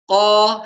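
قا voiced and balanced; correct qaf. Shown as a demonstration. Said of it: "هكذا".

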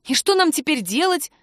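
high-pitched, fretful lament; wailing. A narrator's reading. Woman to lover after the slip, lamenting. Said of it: "запричитала Кира".

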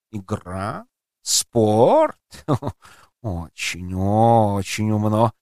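lightly purred, catlike emperor; purring. Acted. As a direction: purr it like a smug cat. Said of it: "промурлыкал Базиль".

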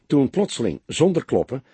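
ordinary delivery, nothing marked

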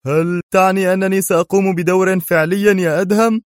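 eyes lighting up with joy; delighted eager question. Acted. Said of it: "تألقت عينا قدري في جذل".

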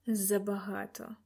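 slow, soft, tired reply; weary, through teeth. A narrator's reading. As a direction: let it out wearily through gritted teeth. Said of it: "утомлено процідив крізь зуби він". (утомлено, крізь зуби)

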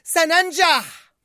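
high, slow drawn-out cry. A narrator's reading. Joy cries out nearing the cliff edge, loudly. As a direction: stretch the shout toward the edge. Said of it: "صرخت فرح".

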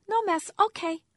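put-on high, loud little-child voice; a toddler agreeing eagerly. A narrator's reading.

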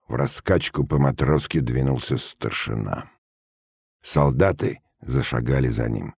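plain reading voice

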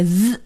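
"z" held as a voiced buzz; friction sound. Shown as a demonstration. Teacher modelling the friction sound for students, "z" sustained. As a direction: buzz "z" with tongue friction, not a letter name. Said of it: "摩擦的音".

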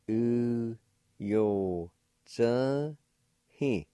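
"U yo zhe he" said as separate syllables. Shown as a demonstration. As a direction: give each syllable of "U yo zhe he" on its own. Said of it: "Pronounced like this".

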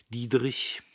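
usual speaking voice

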